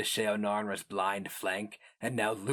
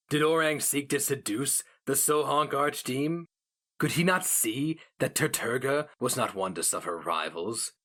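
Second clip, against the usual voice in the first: ordinary delivery, nothing marked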